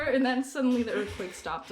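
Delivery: usual speaking voice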